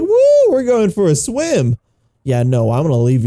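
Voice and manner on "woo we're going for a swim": high, slow, drawn-out whoop; mock cheering. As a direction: cheer for him in a high playful voice. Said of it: "Are you cheering now?".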